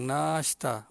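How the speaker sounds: "nashta" with a drawn-out "naa"; long vowel. Shown as a demonstration. Teacher shows what people understand, long "naa" in "nashta". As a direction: lengthen the first syllable of "nashta". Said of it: "Nun alif panjang".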